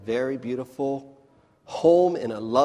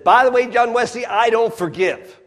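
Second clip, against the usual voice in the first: defiant governor; 10 semitones higher, 9 dB louder than usual. Acted.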